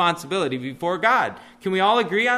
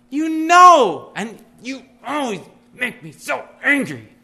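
mock angry accusation; 5 semitones higher, 4 dB louder than usual. Spouse voiced in a sermon, mocking a complaint, mock angry accusation. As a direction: pitch jumps high and loud on you know, then drops to a sulky mutter. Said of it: "We often say that".

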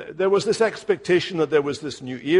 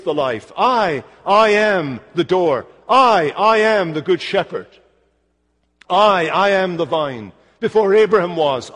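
loud, ringing stress on each repeated "I"; emphatic I; 2 semitones higher, 6 dB louder, slower than usual. Acted. Preacher quoting Jesus' sayings, emphatic "I". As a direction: hammer every "I" slowly and louder than the rest. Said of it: "repetition of the emphatic I".

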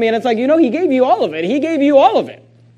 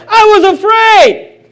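loud high drawn-out wail of excuse; mock whining excuse; 10 semitones higher, 7 dB louder, much slower than usual. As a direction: cry it out slowly, high and loud. Acting the servant's excuse.

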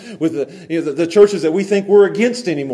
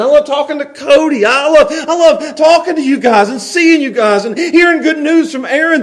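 high, loud fired-up sermon; full-force preaching; 11 semitones higher, 9 dB louder than usual. Acted. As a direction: preach it out to the room with fire.

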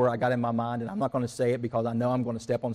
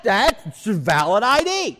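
loud, high, slow insistence; hammed-up angry customer insisting; trumped-up anger; 7 semitones higher, 8 dB louder, slower than usual. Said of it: "I'm giving you the trumped up".

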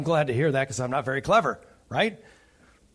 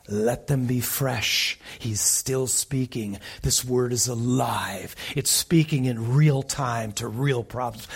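earnest exhortation; 3 semitones lower than usual. Real, not acted. Preacher earnestly urging congregation to listen.

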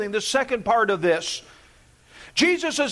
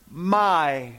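"my" dito grave e destacado; stress on my; 7 semitones lower than usual. Shown as a demonstration. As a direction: lean on the word "my", low and slow. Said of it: "It is the word".

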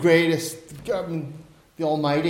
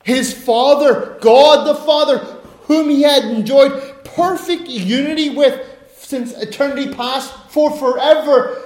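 loud, high, ringing pulpit voice; impassioned sermon delivery; preaching at full force; 6 semitones higher, 6 dB louder than usual. Acted. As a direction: proclaim it to the back row. Preacher building to climax.

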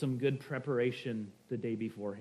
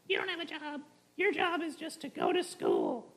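much higher, mock-stern parent lecturing; squeaky high imitation of parents; 12 semitones higher than usual. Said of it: "That's how they sound".

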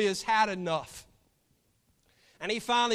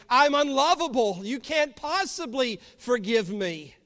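loud, higher cry of unworthiness; impassioned despairing protest; 6 semitones higher, 8 dB louder than usual. Acted. Guilty sinners protesting to Christ on the cross, impassioned. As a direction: preach the despair at full voice.

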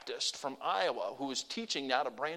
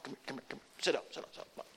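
excited whisper; 2 semitones lower, 7 dB quieter, much faster than usual. Retold or imitated. Deaf grandpa eagerly calling grandson.

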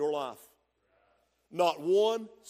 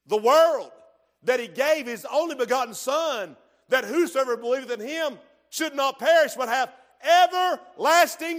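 loud, higher, rising pulpit proclaiming; fervent preaching; 5 semitones higher, 8 dB louder than usual. Acted. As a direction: build the crowd to fire. Preaching climax.

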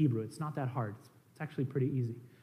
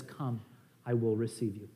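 tender invitation; 7 semitones lower, 9 dB quieter than usual. Acted. Lord's invitation, gently voiced.

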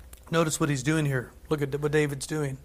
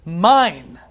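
mine rung out loud and final; proclaimed mine; 9 dB louder than usual. Acted. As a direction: declare it like a possession. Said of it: "present tense".

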